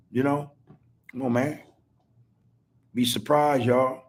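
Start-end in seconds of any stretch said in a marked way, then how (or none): none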